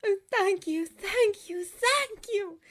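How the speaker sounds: high, loud, gushing thanks; overwhelmed gratitude. A narrator's reading. Girl hugging the sister who healed her, overwhelmed gratitude. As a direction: pour out thanks, overjoyed.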